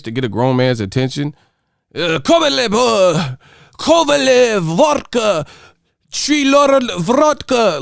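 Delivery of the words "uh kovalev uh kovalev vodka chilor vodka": high mock-Slavic gibberish voice; pitched-up, punched-out nonsense names; speaking in tongues. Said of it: "these dudes be speaking in tongues".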